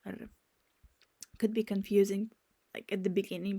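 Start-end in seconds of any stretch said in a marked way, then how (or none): none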